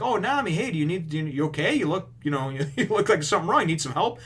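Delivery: a hint of cartoon Luffy; goofy Luffy. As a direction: play him as a cheerful, clueless kid. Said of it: "Luffy's like".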